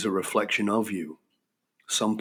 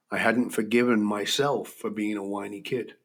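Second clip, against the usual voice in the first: normal talking voice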